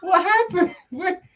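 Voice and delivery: high-pitched, sputtering retold disbelief; exaggerated confused protest, voice shooting up; mock bewilderment. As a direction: squeak it out, utterly baffled. Retold disbelief at mom's switch.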